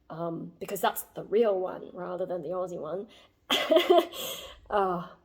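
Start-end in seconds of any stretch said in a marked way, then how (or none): 3.5-4.6 high airless laugh; high unvoiced chuckle; breathy laugh. Real, not acted. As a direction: huff out a laugh under your breath. Laughing about family Survivor.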